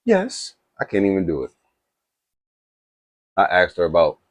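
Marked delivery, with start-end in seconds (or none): 0.0-0.6 high, light imitation of a British AI assistant's "Yes". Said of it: "in her British voice".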